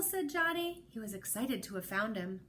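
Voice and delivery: usual speaking voice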